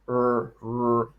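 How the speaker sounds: low curled-tongue American 'er, er'. Shown as a demonstration. Teacher showing the wrong sound, American R. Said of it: "like the American".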